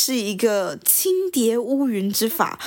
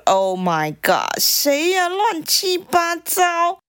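mildly outraged, pitched up at the end; indignant shock; 6 semitones higher, much slower than usual. Acted. Woman startled by an intruder, mildly indignant. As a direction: draw out the last words in affront.

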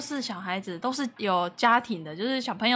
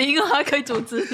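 ordinary talk

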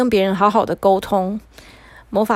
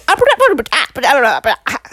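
alien gibberish; 3 semitones higher, 3 dB louder, much faster than usual. Acted. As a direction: babble helplessly in alien talk. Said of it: "他说了一串他自己都听不懂的话".

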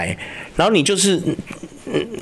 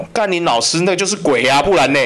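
ordinary delivery

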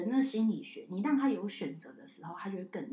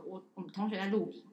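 normal talking voice

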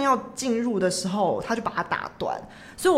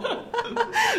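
high laugh. Real, not acted. Cohost laughs at confession.

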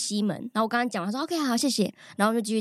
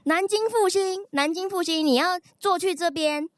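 loud; 7 semitones higher than usual. Retold or imitated. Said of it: "所以我用很大声的音量说".

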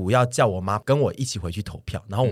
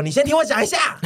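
pitched way up, pressing to be heard; insistent plea. Retold or imitated. Son insisting his mom listen before the vote, high and pressing. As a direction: keep cutting in, begging her to listen. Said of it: "然后我就一直说".

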